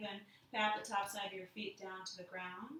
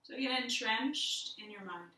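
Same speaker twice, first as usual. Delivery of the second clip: guided meditation. Acted. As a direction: lead them inward gently, letting silence sit. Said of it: "we'll do a little guided meditation".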